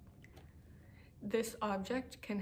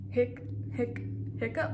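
hiccups; 3 semitones higher than usual. Acted. Clifford hiccups at vet.

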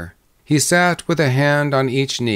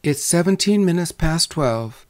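higher-pitched, lightly coy bride; mildly coquettish, pitched up; shy coquetry; 6 semitones higher than usual. Acted. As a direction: answer with a touch of shy flirtation. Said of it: "shy and clumsy coquetry".

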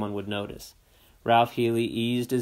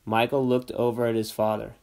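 plain reading voice

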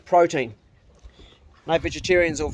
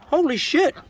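high startled "holy shit"; surprised exclamation; 10 semitones higher than usual. Real, not acted. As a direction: blurt it out in shock. Finds worm juice again.

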